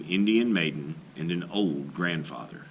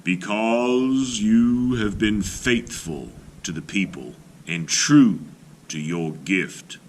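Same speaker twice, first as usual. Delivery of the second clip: slow, louder spirit voice; calling voice; 4 dB louder, slower than usual. Acted. Night voice tells faithful Little Gopher he will find the colors, slow and louder. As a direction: call out slowly, like a voice from a dream. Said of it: "he heard a voice calling to him".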